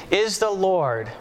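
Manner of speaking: ordinary talk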